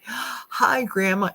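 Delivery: high, eager grandchild voice on the phone; a scammer playing the sweet grandkid; scam call grandchild. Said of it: "impersonating a grandchild".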